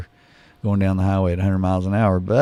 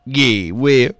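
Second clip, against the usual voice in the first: drawling greeting; 3 semitones higher, 5 dB louder, much slower than usual. Acted. Radio host drawling greeting.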